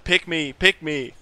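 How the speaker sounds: high, eager pretend shout; mock chat shout. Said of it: "just shout in chat".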